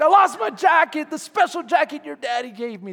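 high, loud frantic confession; hammed-up frustrated wail; freaking out. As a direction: blurt it out loudly in mock panic. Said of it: "freaking out".

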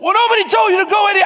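high, rapid exasperated burst; frustrated husband blurting it out; frustrated outburst. Retold or imitated. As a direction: re-enact losing your patience, fast and high. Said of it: "I get frustrated".

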